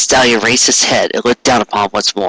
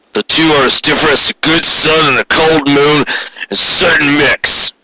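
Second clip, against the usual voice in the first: gruff boss Quarry; 4 semitones higher than usual. A narrator's reading. Boss calling from his office to his clerk, a cynical lecturing voice. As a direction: voice him as a hard-nosed boss laying down the law.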